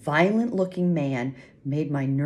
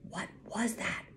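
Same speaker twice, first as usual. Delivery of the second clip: soft, high, anxious-sounding whisper; hushed, higher-pitched question; 6 semitones higher, 8 dB quieter than usual. A narrator's reading. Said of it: "I whispered".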